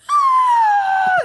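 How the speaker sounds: high, loud piercing scream; blood-curdling scream. Retold or imitated. Scaring a dog off her own dog, piercing loud scream. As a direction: let rip a scream that could break the sound barrier. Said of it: "And I'm like".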